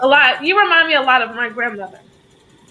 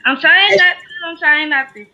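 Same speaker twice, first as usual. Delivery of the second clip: holding back tears; 4 semitones higher than usual. Real, not acted. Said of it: "I can't promise not to cry".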